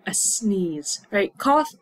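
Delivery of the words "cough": short, high, loud mock cough; fake cough. Shown as a demonstration. To learners, contrasting cough with sneeze, fake cough.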